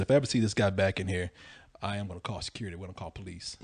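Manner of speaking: hushed warning from a bouncer; low stern threat. Retold or imitated. Bear warning Leon about his friend, low and hushed. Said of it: "He said".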